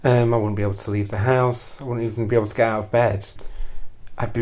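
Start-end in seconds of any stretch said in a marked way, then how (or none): none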